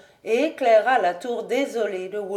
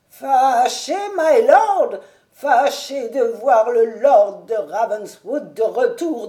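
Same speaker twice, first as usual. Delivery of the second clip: put-on indignant old servant; 3 semitones higher, 7 dB louder than usual. A narrator's reading. Old servant to his master, hurt and indignant. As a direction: protest like a wounded old retainer.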